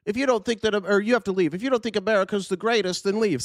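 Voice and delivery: high, sneering parody of a flag-waver; mocking nationalist quote. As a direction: mimic the my-country-or-leave crowd, scornfully. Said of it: "a dumb fucking thing to say".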